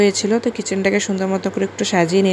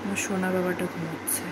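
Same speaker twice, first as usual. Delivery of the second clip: speaking softly; 3 semitones lower, 11 dB quieter than usual. Real, not acted. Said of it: "আস্তে আস্তে বলছি".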